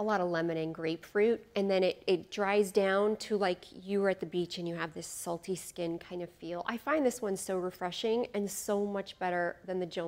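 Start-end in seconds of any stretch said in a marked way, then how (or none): none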